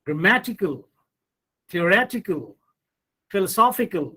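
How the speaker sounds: gramMAtical, theoRETical, philoSOPHical; stress before the suffix. Shown as a demonstration. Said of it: "the syllable that comes immediately before these suffixes".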